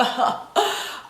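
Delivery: a short breathy giggle at herself; light laugh. Real, not acted. Vlogger laughing at her own glowy look, a breathy giggle. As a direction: laugh off the celebrity joke.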